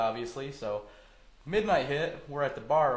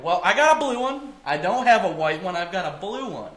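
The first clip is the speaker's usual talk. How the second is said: defiant retort; 5 semitones higher, 8 dB louder than usual. Retold or imitated. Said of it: "I'm like".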